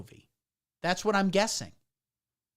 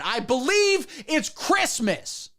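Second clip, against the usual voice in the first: slow, higher, drawn-out threat; slow and higher, daring anyone to disagree; menacing insistence; 7 semitones higher, slower than usual. Retold or imitated. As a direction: lean on it like a man with a gun. Dad holding a gun, insisting.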